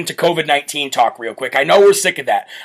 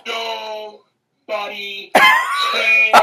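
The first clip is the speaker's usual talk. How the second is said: mock crowd reply; 5 semitones higher, much slower than usual. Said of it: "they say".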